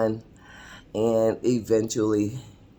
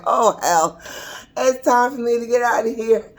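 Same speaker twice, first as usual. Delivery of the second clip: mock alarm; 10 semitones higher than usual. Reacting to an unwanted proposal, hammed-up alarm. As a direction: exclaim it as if ready to flee.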